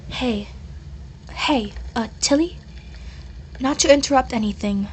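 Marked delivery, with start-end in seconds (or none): none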